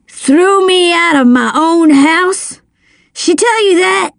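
indignant demand. A narrator's reading. Drunken father confronting his son over the house, indignant.

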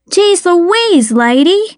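high-pitched indignant exclamation, little added volume; lightly acted cry of annoyance; mild shout. A narrator's reading. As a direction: pitch leaps up on a mock shout. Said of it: "I shouted".

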